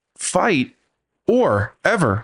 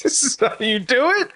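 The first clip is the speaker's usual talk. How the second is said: incredulous; 10 semitones higher than usual. Real, not acted. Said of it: "mocking disbelief".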